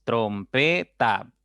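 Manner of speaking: "trompeta" slowly broken as trom-pe-ta; split into syllables. Shown as a demonstration. Teacher repeats the three syllables, "trompeta" syllable by syllable. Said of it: "tiene tres sílabas".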